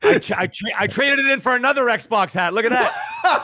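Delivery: high, loud, laughing boast; excited showing off. Real, not acted. Guest showing off another Xbox hat, excited boast.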